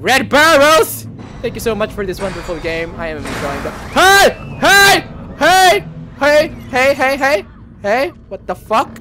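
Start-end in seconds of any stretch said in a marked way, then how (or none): 0.0-1.1 loud, high, drawn-out name; raised, stretched-out holler; exasperated shout. Real, not acted. Calls out the game's developers. 3.9-8.1 high, loud repeated yells; startled calling out; alarmed shouting. Real, not acted. Alarmed by something in the game.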